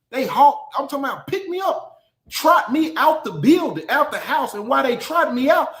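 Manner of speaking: ordinary delivery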